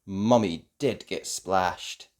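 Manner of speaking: ordinary delivery, nothing marked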